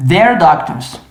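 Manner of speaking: "they're doctors" run together quickly; sped up contraction. Shown as a demonstration. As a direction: rush it, contracted. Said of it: "agora eu quero acelerar".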